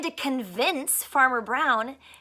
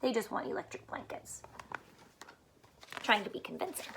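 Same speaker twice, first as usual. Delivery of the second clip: plain reading voice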